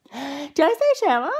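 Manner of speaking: laughing, high and loud; catching her own odd word. Real, not acted.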